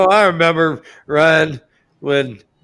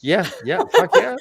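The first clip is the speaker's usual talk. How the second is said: laughing cheer; 7 semitones higher than usual. Real, not acted.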